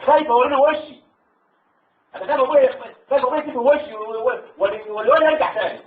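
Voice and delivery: high-pitched, anguished fretting without sobs; retold tearful dread. Soldier fearing his father's shame, retold anguished worry. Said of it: "والدموع نازلة".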